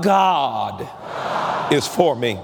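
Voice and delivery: God punched out loud, rest dropped low; stress on God. Shown as a demonstration. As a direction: shout the word God, then let is for me fall away. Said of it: "Say it out loud".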